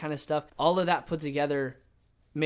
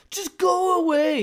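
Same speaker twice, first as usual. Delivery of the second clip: mock furious yell, high and drawn out; angry shout; 6 dB louder, slower than usual. [name] shouts at Elle in their falling out, angry. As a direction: act out a loud, slow brush-off.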